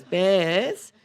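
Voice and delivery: high, loud mock mum calling out; hammed-up call from a mum; Bill's mum. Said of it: "then Bill's mum goes".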